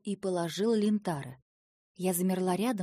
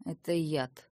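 равнодушно; 3 semitones lower, slower than usual. A narrator's reading. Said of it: "равнодушно пояснил он".

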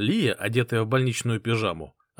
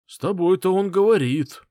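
loud, higher, grumbling displeasure; displeased; 8 semitones higher, 6 dB louder than usual. Acted. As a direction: complain with clear resentment. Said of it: "недовольно сказал он". (недовольно)

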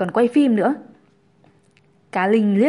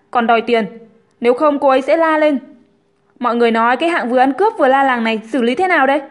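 louder, quick appeal to onlookers; indignant Hùng; 4 semitones higher, 6 dB louder, faster than usual. A narrator's reading. Hùng tells the crowd she's lying, mildly indignant. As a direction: deny everything and turn the crowd against her.

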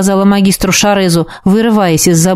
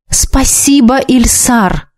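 higher, schoolmasterly prompt; didactic prompting; 4 semitones higher, much slower than usual. A narrator's reading. Dark mage tells his ward what to say, didactic prompting. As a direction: prompt the words as if teaching manners. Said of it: "назидательно подсказал тёмный маг".

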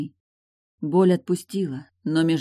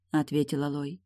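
usual speaking voice

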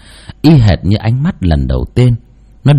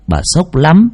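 ordinary delivery

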